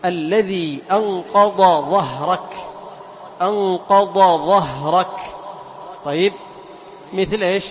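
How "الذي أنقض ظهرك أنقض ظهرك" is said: أنقض ظهرك slow, ض and ظ each pronounced from its own point, louder; slow, emphatic, distinct ض then ظ; separate ض and ظ. Shown as a demonstration. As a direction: say أنقض ظهرك with a clear break between ض and ظ. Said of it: "لا بد أن تخرج كل حرف من مخرجه الصحيح".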